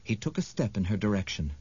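ordinary delivery

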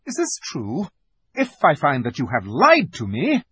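high cry turning to threat; imperious, suspicious demand; alarmed queen. A narrator's reading. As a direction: start high in shock, then settle into warning. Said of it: "cried the Queen".

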